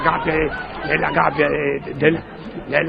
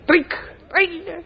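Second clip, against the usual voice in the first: high trill. Retold or imitated. Imitates a trill sound.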